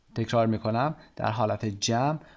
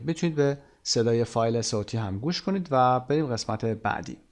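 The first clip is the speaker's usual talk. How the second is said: ordinary talk, nothing marked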